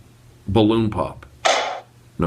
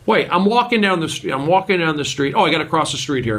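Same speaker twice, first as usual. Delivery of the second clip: normal talking voice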